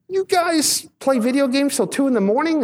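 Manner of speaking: high, disbelieving question; incredulous, high. Retold or imitated. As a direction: react in shocked disbelief. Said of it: "Like, I said".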